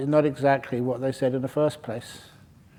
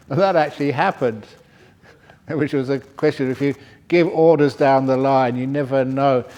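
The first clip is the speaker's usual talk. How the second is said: ordinary delivery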